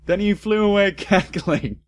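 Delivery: high, laughing through it. Real, not acted. Reader laughing at the villain, laughing. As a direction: crack up while reading.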